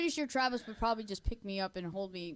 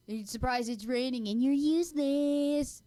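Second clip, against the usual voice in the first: mock Ed taunt; 5 semitones higher, 5 dB louder, slower than usual. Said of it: "dressed as Ed and go like".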